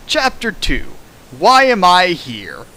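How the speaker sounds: ordinary delivery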